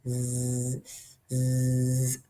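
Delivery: soft, low sleepy snore; cartoon snoring in sleep. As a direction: drift off into a deep snore. Friends asleep after camping.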